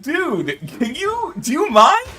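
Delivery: high, loud put-upon whine; mock offended. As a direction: whine at the game like it wronged you. Mock offended reply to game.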